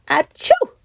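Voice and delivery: high hammed-up sneeze; mock sneeze. A big monster sneezing at the stinky cheese, hammed-up sneeze. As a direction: act out a big fake sneeze.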